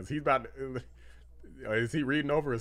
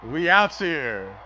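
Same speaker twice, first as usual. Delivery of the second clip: hype shout; 11 semitones higher, 9 dB louder than usual. Real, not acted. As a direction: roar it like a victory chant. Cheering as Trump arrives.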